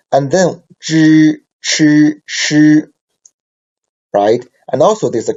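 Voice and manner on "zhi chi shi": "zhi, chi, shi" louder, higher and slow, each syllable apart; pinyin demo. Shown as a demonstration. Teacher models the retroflex group for the class, loud and clear. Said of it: "review about the two groups".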